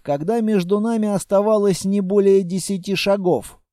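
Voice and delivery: ordinary delivery, nothing marked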